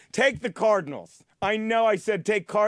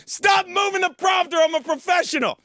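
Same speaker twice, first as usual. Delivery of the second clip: shouted on-set tantrum; outraged shout; 6 semitones higher than usual. Real, not acted. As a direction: yell it like an indignant star. Snaps at crew over prompter.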